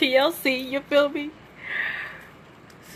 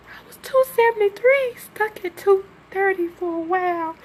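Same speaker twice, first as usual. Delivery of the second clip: high, shaky, crying through it; real tears breaking the voice; snotty crying; 6 semitones higher than usual. Real, not acted. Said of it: "I got an ugly cry".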